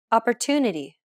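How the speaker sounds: Opportunity with the TU stretched longer; stress on TU. Shown as a demonstration. Teacher repeats Opportunity for learners, stressed TU. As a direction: draw out the TU in Opportunity. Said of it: "Listen to it again".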